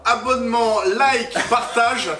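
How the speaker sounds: hype friend cheering. Acted. Cheering subscribe call.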